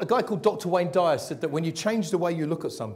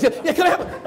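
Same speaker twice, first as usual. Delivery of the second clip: high, loud, overeager helper voice; eager waitress; 11 semitones higher, 8 dB louder than usual. Retold or imitated. Waitress rushing to serve the diner, overeager and high. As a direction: gush like a waitress desperate to please. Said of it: "eager waitress again".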